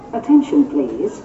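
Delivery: ordinary delivery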